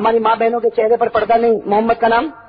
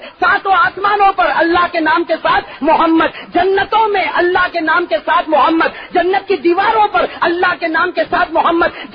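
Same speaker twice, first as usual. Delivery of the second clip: rising to a high, loud preaching cry; chanted refrain rising higher each line; fiery preaching; 7 semitones higher, 3 dB louder than usual. Acted. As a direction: build the sermon to a shouting climax. Preacher building to full force.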